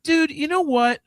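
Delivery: a fed-up fan losing it; retold anger. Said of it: "I was so goddamn angry".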